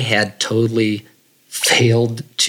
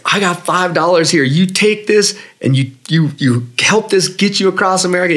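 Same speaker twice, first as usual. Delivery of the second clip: gushing veteran; 8 semitones higher than usual. Retold or imitated. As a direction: pour out admiration at full pitch. Veteran giving mom money.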